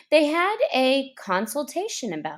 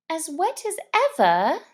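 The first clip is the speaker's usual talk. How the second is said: mildly melancholy; 7 semitones higher, faster than usual. Acted. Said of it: "in a melancholy tone".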